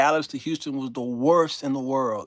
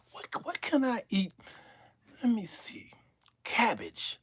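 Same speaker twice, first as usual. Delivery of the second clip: hushed, plotting whisper; scheming whisper; 6 semitones higher, 7 dB quieter than usual. Retold or imitated. The gassy passenger plotting his meal, whispering. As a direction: whisper the greedy plan.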